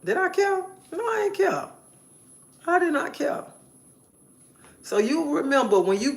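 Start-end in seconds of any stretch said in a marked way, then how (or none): 0.0-3.4 much higher, insistent I did not care; defiant. Real, not acted. Answering her own question about the callers, high and defiant. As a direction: shoot it up high in proud defiance. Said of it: "defiant answer to her own question".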